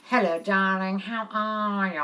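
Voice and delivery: slow, drawn-out private-school mum voice; private-school mothers. Acted. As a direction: stretch every vowel like an affected school mother. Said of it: "That's how the mothers at my high".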